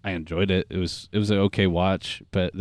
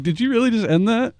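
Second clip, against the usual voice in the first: high incredulous quote; 11 semitones higher than usual. Retold or imitated. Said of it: "He'd be like".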